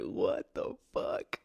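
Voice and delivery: slow, breathy, stunned; disbelieving whisper. Retold or imitated. Driver reacting in disbelief to girls in the back seat. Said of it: "I'm just like".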